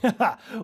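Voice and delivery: short burst of real laughter; amused laugh. Real, not acted. Radio host laughing at a caller's odd tale. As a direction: laugh it off, amused and a bit thrown. Host reacts to weird story.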